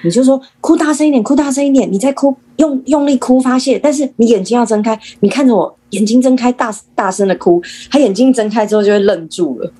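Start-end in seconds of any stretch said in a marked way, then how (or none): 0.6-7.6 high, insistent parental coaching; urging on. Shown as a demonstration. Showing parents what to tell a crying child, urging. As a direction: push the child to let it out, pitched up throughout.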